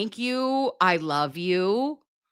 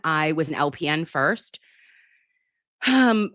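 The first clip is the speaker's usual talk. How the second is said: normal talking voice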